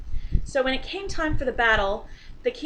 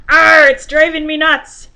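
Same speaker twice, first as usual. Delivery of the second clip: loud pirate growl, pitched higher; 5 semitones higher, 15 dB louder than usual. Acted. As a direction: bellow it like a salty pirate. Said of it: "And the pirate says".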